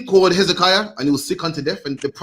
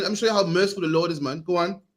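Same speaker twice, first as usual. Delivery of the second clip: ordinary delivery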